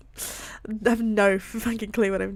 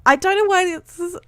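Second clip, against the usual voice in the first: choked-up apology rising high; emotional; 9 semitones higher, 9 dB louder than usual. Real, not acted. Reader apologising for getting emotional, voice rising tearfully. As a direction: start subdued, climb into a tearful high pitch. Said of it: "I'm getting emotional".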